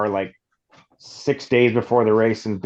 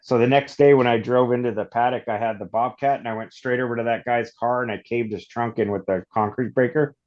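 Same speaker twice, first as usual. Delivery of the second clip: ordinary talk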